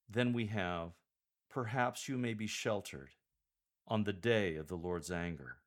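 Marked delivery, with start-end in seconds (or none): 1.5-5.6 slight pause after "sheltered", stress on "day". Shown as a demonstration. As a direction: break briefly after "sheltered", then lean on "day". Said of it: "Insert a slight pause after the word sheltered".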